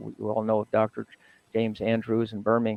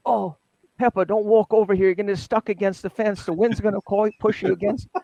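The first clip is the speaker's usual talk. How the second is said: high, fast playful warning; teasing Reggie; 9 semitones higher, faster than usual. Retold or imitated. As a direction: rib Pepper in a much higher, quicker voice. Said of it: "and say".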